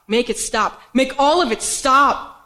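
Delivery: slow plea building to a full scream; desperate plea. Acted. Performer reliving a breakdown begs it to stop, desperate and building to a scream.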